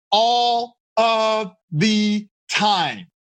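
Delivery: ALL OF THE TIME, each word stretched out very slowly; drawn-out emphasis. Real, not acted. Speaker answering his listeners' guesses, slow word-by-word emphasis.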